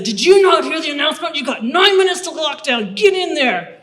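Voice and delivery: high, loud barking shout, fast at first; bruiser guard shouting. Retold or imitated. Prison guard yelling at the new arrival to get inside. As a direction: bellow orders at a dazed recruit. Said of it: "this really big bruiser of a woman".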